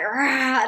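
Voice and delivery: loud mock roar. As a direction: let out a big roar. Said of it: "and I was like".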